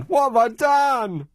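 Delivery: high, drawn-out wounded protest; exaggerated plaintive protest; mock outrage. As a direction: ham up the wronged innocent, slow and high. Repeats it as hammed-up protest.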